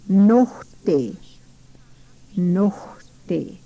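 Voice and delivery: Nohte with a throaty h before the te; teacher modelling guttural Nohte. Shown as a demonstration. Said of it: "It's a guttural word".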